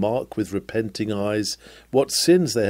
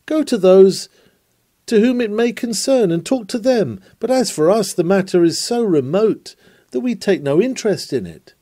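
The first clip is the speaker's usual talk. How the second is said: higher, brushing-off imagined hearers; mock dismissive listener; 8 semitones higher, 4 dB louder than usual. Preacher voicing hearers who dismiss him, higher and dismissive. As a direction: wave the preacher off as hearers might. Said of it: "I should not marvel if you were to say".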